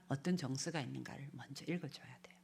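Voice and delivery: ordinary talk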